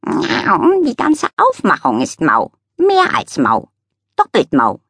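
high, slow cat voice full of scorn; a prissy cat sneering slowly at a chair; cat tapping the chair with contempt; disdainful cat. Acted.

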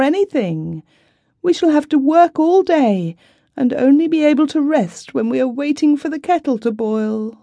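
high, plaintive complaining voice; a distressed wife pouring out her worries; tearful young wife. A narrator's reading.

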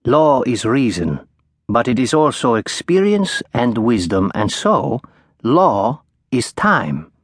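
a lightly voiced elderly law professor, measured and wise; a hint of a wise old professor; old professor lecturing. A narrator's reading.

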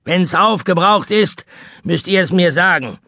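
high-pitched, lordly sailor voice; haughty old seaman. Acted. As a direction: toss it off like a commanding captain. Seaman pays in advance.

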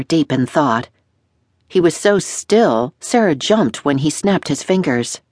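ordinary talk, nothing marked

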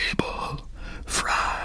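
slow, breathless whisper as he passes out; fading whisper. A narrator's reading. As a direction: let the name die away. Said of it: "he muttered two last words".

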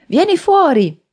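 high, loud shouted command; yell. A narrator's reading. Man ordering his hidden foe out, angry yell. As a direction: shout it through a shut door, pitch way up. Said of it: "urlò".

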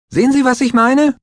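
very high, loud exasperated question; indignant outcry. A narrator's reading. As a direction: shriek it, pointing at the driver. Klinger indignant, pointing.

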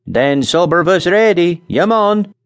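put-on accented call to eat; Scandinavian accent. A narrator's reading. Nels calls Charlie to supper in a Scandinavian accent.

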